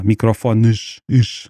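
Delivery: "microfones" ending in a drawn-out "sh"; slow "microfones", the final s as "ish"; final sh sound. Shown as a demonstration. Linguist showing Portuguese word endings, hushed sh. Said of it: "Temos o x, por exemplo, no final".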